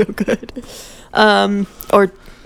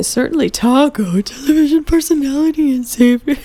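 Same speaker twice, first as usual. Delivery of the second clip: higher put-on showman voice; Taco voice; 7 semitones higher than usual. Reader attempting the blurb in character, Taco voice. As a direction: announce it as a showy character. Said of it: "should I do the Taco".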